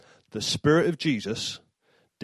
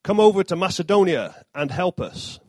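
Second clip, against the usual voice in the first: raised, urgent plea for help; pleading; 6 semitones higher, 5 dB louder than usual. A narrator's reading. Macedonian man in Paul's vision, raised pleading. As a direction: beg him to come and help. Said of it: "pleading with him".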